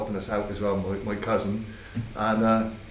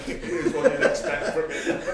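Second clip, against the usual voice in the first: high-pitched words through laughter; laughing. Real, not acted. Joking about Jeff's sending off, laughing.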